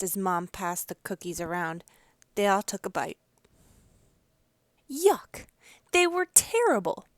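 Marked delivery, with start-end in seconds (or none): 4.9-7.1 disgust. A narrator's reading. Tasting the salty cookies.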